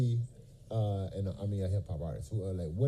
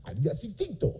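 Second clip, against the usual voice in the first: Spanish TV announcer; 4 semitones higher than usual. Retold or imitated. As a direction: imitate a Spanish channel announcer. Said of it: "You know".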